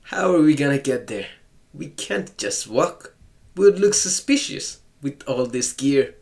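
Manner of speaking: ordinary talk, nothing marked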